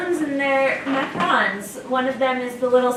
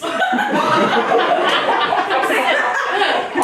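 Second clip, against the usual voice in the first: loud, breathy laugh; 7 semitones higher, 6 dB louder than usual. Real, not acted. Player laughs loudly at her own New Zealand joke.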